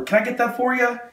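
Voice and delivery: high-pitched call at normal volume; retold calling over. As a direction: sing it out high, as if across a doorway. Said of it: "I yell over".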